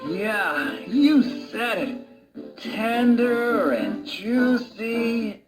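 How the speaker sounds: slow, savouring drawl; dreamy craving. Acted. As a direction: drift into a hungry daydream, slowly. Wolf lost in tempting food thought.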